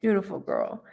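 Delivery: normal talking voice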